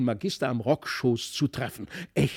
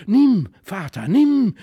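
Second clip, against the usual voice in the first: loud, high, urgent cry; calling out; 5 semitones higher, 9 dB louder, slower than usual. A narrator's reading. Felix handing father the fly swatter, calling out urgently. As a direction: call out high and insistently. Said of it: "rief er".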